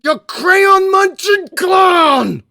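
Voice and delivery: slow, high, loud mock insult; mock shout. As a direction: bellow it like a mock taunt. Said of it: "Talk to me like I'm a baller".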